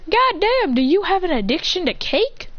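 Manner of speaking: high exasperated outburst. Acted. Boy scolds his cake-mad sister, high exasperation. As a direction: throw up your hands at her.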